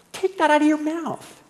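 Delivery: high, indignant mock scold. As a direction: scold him like a shocked coworker. Coworker scolding him.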